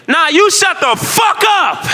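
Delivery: very high, slow shout; yelling back. Retold or imitated. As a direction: fire it right back at him. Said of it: "I just yelled back".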